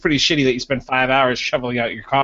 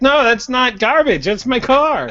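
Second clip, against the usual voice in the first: much higher, louder, slow offended protest; indignant owner; 11 semitones higher, 4 dB louder, slower than usual. Retold or imitated. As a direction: insist the junk is his car. Voicing Joe defending car.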